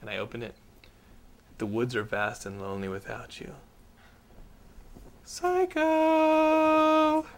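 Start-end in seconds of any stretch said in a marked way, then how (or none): 1.6-3.5 hushed reading. Retold or imitated. Reading ex's creepy note. 5.9-7.2 high, drawn-out mock creepy voice; hammed-up creepy note signature; creepy high sign-off.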